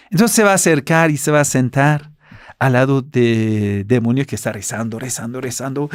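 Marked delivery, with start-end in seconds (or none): none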